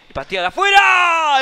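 high loud slowed shout; excited shout. Real, not acted. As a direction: cry out at the near miss. Shot just wide, commentator shouts.

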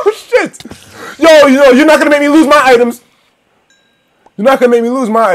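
high, loud frantic pleading; frantic yell. Real, not acted. Player yells at the spider threatening his items, frantic yell. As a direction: yell desperately at the monster.

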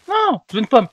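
whiny yell. Real, not acted. Gamer yelling at the game for an apple, whiny shout. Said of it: "faudrait que ça arrête de crier dans mon micro".

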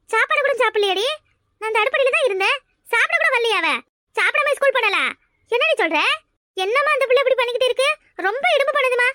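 mother venting about the daughter who skipped food; exasperated mother. Acted. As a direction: vent at the stubborn daughter.

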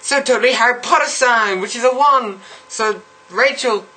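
put-on high bubbly valley girl voice, every totally gushed; mock valley girl accent. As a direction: play a ditzy valley girl gushing to her friends. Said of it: "I really can't do this accent".

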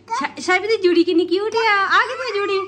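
high, loud cooing. Real, not acted. Cooing at baby.

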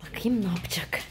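soft unvoiced whisper to herself. Real, not acted. Mother whispering while watching her son. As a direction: whisper as if not to be overheard.

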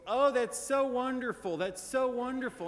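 hammed-up doting grandmother gushing; gushing grandma. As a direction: heap delighted praise on him like a grandmother. Grandma gushing while he works.

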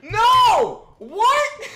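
high, loud disbelieving shout; shocked shout. Real, not acted. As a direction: yell out at the awful clip.

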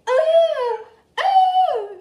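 high, loud shrieks of fright; startled scream. Real, not acted. Girl shrieking when her toy dragon suddenly moves. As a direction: shriek as it jumps at you.

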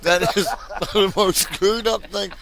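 cracking up mid-sentence; laughing hard. Real, not acted. Cracking up over the game.

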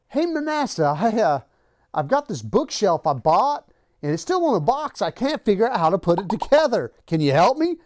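put-on high, folksy old man's request; old man asking a favor. Mom's ex asks for help.

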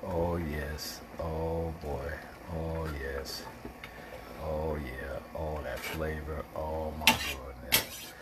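real quiet delight at the flavor; getting happy. Real, not acted. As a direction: murmur low with pleasure over the taste. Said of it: "I'm getting happy".

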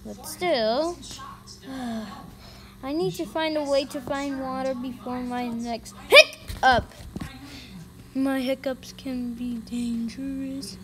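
usual speaking voice

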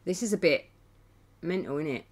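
ordinary talk, nothing marked

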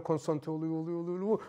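low, hushed repetitive murmur; building concentration. Shown as a demonstration. Teacher showing students how a karateka focuses, low and hushed. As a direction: softly repeat it as focus gathers. Demonstrating a fighter gathering concentration.

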